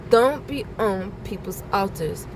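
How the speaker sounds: normal talking voice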